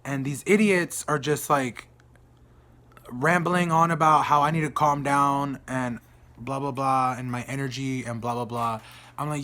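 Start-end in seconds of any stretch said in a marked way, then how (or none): none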